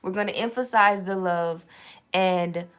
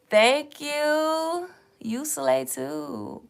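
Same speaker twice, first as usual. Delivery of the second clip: cutesy thanks; 8 semitones higher, much slower than usual. Pretend. Thanking viewer compliment.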